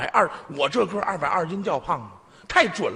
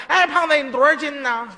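mocking call; 6 semitones higher than usual. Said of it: "赶着那帮人问我".

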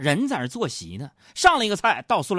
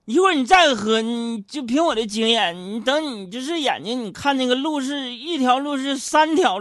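loud, high, pushy uncle; boozy friend shouting him down; insistent drinking buddy; 9 semitones higher, 5 dB louder than usual. Retold or imitated. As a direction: bellow at him to stay and drink. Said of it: "另一个就不让".

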